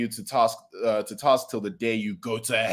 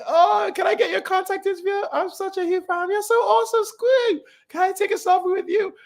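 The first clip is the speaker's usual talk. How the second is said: much higher, squeaky starstruck fan; fangirl voice. Retold or imitated. First years begging heroes for contact, squeaky fangirl voice. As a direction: gush like an excited fan. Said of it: "They're like".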